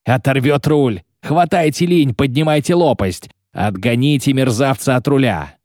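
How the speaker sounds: high, strained shouting; frantic yelled orders. A narrator's reading. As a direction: bellow urgent orders to the crew. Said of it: "надрывался подларак". (надрывные крики-команды)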